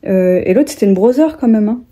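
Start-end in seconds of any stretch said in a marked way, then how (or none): 0.9-1.4 English brand name said with a French accent; French-accented "brother", th turned to z. Shown as a demonstration. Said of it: "je vais juste prendre l'accent français".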